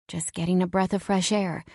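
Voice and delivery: ordinary delivery, nothing marked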